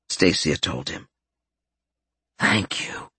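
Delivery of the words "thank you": barely there breathless whisper. Acted. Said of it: "he barely whispered".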